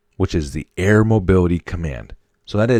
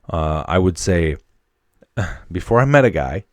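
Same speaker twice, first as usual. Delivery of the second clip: normal talking voice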